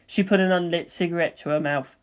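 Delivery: usual speaking voice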